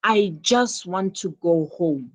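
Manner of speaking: "just" given extra weight; stress on just. Shown as a demonstration. Teacher repeating the example for pupils, stress on "just". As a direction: hit "just" firmly, then finish the sentence. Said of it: "the emphasis is on just".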